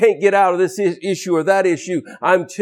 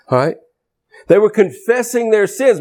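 ordinary talk

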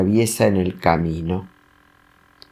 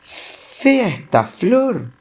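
high, slow, unsure little voice; falter, drawn out and high, like a timid child; hesitant boy; 11 semitones higher, much slower than usual. Acted. Serafín stammering at the angry lion. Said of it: "titubea Serafín".

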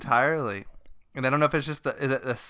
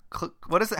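ordinary talk, nothing marked

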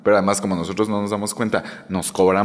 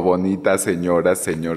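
normal talking voice

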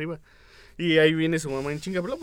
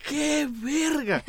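slow, high mock exclamation with a laugh; high laughing impression of the character; retold exclamation; 10 semitones higher, much slower than usual. Friend retelling a horror movie scene, slow high mock "qué verga".

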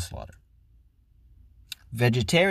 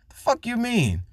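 incredulous; 10 semitones higher than usual. Real, not acted. Ranting at pescatarians.

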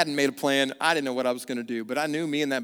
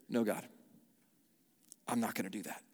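soft, low reluctant reply; quiet refusal; 4 semitones lower, 10 dB quieter, faster than usual. Retold or imitated. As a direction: murmur it, unwilling. Voicing a reluctant believer.

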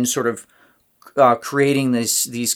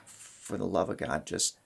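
much softer, lower desperate plea under the breath; exasperated mutter; 7 semitones lower, 15 dB quieter than usual. Retold or imitated. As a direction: beg quietly through clenched patience in church. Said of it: "you're just like".

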